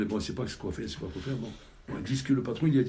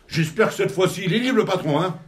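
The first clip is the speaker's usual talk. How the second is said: higher and louder, pointedly insistent; pointed demand; 9 semitones higher, 11 dB louder than usual. Acted. Chief of staff arrives at the office, pointedly insistent. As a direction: lean on it, a loaded hint.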